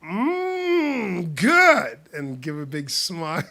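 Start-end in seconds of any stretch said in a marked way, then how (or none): 0.0-2.0 high, loud ad-style mmm good; commercial delight. Retold or imitated. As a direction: sell the burger with big joy. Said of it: "bite into it and then go".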